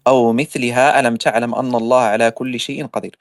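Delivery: low, steady recited Arabic, speeding up at the end; Quran recitation. Shown as a demonstration. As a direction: recite the verse in Quranic cadence. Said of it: "I'm gonna read the verse again".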